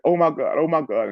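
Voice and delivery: distressed, high exclamation, lightly acted; breaking down in shock. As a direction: imitate a distraught onlooker. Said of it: "she just broke down".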